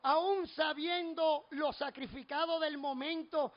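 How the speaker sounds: loud, slow, drawn-out sermon climax; full-force preaching. Real, not acted. Preacher declares what love for God makes him do, full-force preaching. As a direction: build to a forceful rhythmic declaration, punching each phrase.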